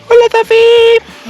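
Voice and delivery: high slow sing-song hello. Real, not acted. Greeting baby in belly. (hablando a la bebé)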